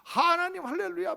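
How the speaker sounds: high-pitched, ecstatic cry of 하나님 할렐루야; ecstatic praise. Retold or imitated. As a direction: shout it out, swept up by the Spirit. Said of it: "방언도 하고 막". (성령 받아 높이 외치는 할렐루야)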